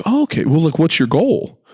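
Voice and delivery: high, chirpy sales pitch; mock salesman. Clerk asking Ian about his goals, in a high, over-keen voice. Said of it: "the guy's like".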